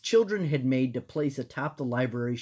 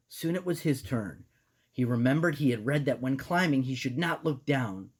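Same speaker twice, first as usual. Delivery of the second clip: plain reading voice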